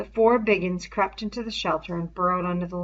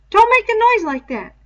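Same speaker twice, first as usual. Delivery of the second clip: put-on much higher, louder anxious boy; frightened scolding; 12 dB louder than usual. A narrator's reading. Piggy scolding Ralph in the shelter, high anxious voice. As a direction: scold him out of fear.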